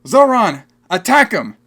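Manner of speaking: high, loud mock battle order; imitating a spirited movie warrior; spirited command. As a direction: call out an attack order, hammed-up. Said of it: "she was so spirited".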